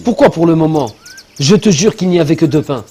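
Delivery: swearing his innocence, loud and high; defensive insisting. Acted. Insists he isn't lying.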